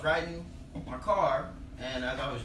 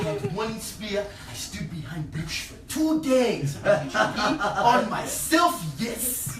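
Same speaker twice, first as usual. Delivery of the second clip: put-on slow, high, emphatic African accent; African storyteller; 5 semitones higher, 5 dB louder, much slower than usual. African friend recounting his lion hunt, intense storytelling. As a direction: draw out the tale in an African accent. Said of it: "old people World War Two stories".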